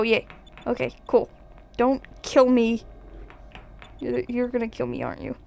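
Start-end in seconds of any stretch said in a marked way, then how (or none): none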